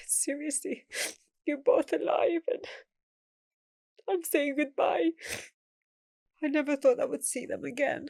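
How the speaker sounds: high, strained voice, close to tears, real emotion; holding back real tears while remembering the goodbye; choked up. Real, not acted. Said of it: "It's just been emotional".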